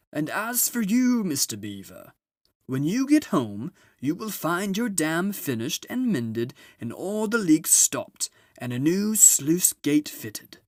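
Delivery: high, booming jolly Father Christmas; a grand, merry old gift-giver arriving at last; Father Christmas announces his arrival to the children. Acted. Father Christmas to Mister Beaver.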